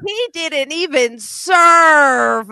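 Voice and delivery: high, loud, very slow shout of indignation; outraged shout. Retold or imitated. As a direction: yell it across the cafeteria. Other side shouting back in fight.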